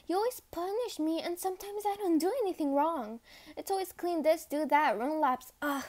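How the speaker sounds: rapid, heated complaint; frustrated rant. Acted. Cadet complaining of constant punishment, frustrated rant. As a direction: rattle off the grievances in exasperation. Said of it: "you were yelling at your superior".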